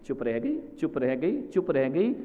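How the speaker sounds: low, hushed repetition; subdued. Real, not acted. Preacher on wife who cannot speak, low and subdued. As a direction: say it softly, as if silenced. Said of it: "बेचारी बोल भी नहीं सकती है".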